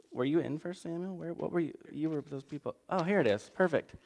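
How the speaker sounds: ordinary talk, nothing marked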